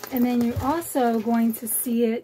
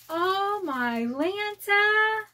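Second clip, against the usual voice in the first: slow, higher, drawn-out awe; awed gasp; 7 semitones higher, much slower than usual. Real, not acted. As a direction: stretch it out in wonder. Gasping at what's inside.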